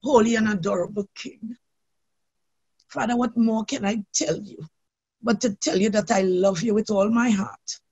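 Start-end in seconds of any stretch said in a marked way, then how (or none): none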